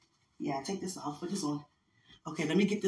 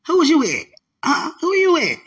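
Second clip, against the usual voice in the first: high, loud, playful interrogation; mock jealous grilling; 9 semitones higher, 7 dB louder than usual. Grilling sweetheart about a past relationship, mock jealous. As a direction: demand answers in mock outrage. Acted jealous questioning of partner.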